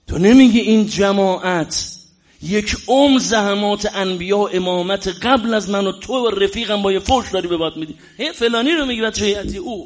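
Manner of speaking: preacher voicing the Imam's rebuke to his friend; impassioned preaching. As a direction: scold the congregation with fervour.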